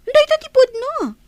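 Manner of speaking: high, loud furious snap; angry outburst. Acted. Mother shouting at her son's wife, furious. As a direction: lash out at her in a rage.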